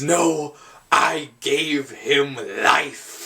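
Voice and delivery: a lightly voiced mad scientist, very slow; slow dramatic line. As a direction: draw out each word like a grand claim. Herbert West's opening line.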